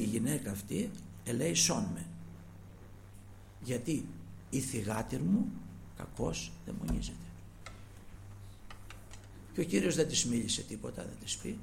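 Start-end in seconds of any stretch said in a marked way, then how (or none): none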